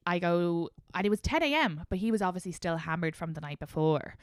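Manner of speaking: normal talking voice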